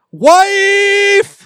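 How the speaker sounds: loud, high shout; yelling. Acted. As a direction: call out in alarm. Husband yelling in the dark.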